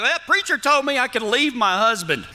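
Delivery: high mock wife voice, lightly done; a hint of a wife twisting the preacher's words, pitched high. Preacher imitating a wife who misreads his advice, high mock voice. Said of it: "you're gonna be like".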